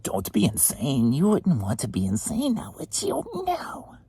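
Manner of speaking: playful put-on voice leaping to a squeak; silly teasing voice. Teasing viewers about being insane, silly squeaky finish.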